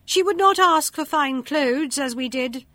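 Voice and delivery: spiteful. A narrator's reading. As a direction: sneer at her, shrill with spite. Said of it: "said all manner of ill-natured things".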